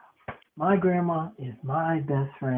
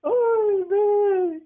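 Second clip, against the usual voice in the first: high squeal of delight; excited squeal; 3 dB louder than usual. Real, not acted. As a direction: gush over her, very high. Squeal on seeing grandma.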